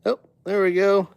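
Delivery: ordinary talk, nothing marked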